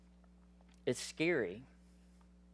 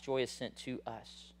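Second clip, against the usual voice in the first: softer echo of "Joy is sent to us", trailing down; soft repeated point; 4 semitones lower, 14 dB quieter than usual. Shown as a demonstration. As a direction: let "Joy is sent to us" sink in quietly. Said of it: "Number three".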